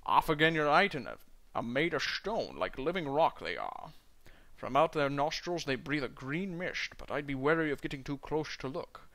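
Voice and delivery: Scottish burr, rolled and heavy, dwarf in a tavern; a Scottish dwarf doing his best Connery; Sean Connery impression. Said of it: "do a Sean Connery impression".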